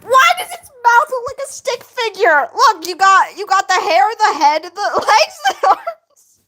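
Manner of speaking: high, loud, breaking into laughter; real incredulous laughing outburst; laughing disbelief. Real, not acted. Streamer picks apart an old drawing, laughing disbelief. Mocking a badly drawn figure.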